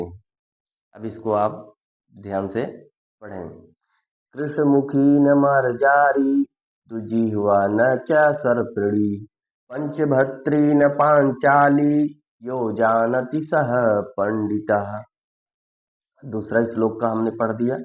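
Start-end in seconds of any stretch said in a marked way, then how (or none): 4.3-15.0 slow, loud chanting recitation of the shloka; Sanskrit verse recitation. Acted. Teacher reciting a Sanskrit shloka to students, slow and loud. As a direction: intone each line slowly and loudly, like a recitation.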